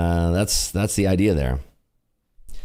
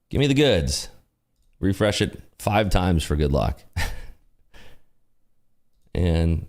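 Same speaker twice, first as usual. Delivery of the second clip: normal talking voice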